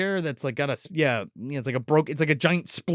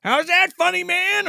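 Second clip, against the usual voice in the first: mock tough one-liner; 12 semitones higher, 4 dB louder than usual. Hero quoting movie line.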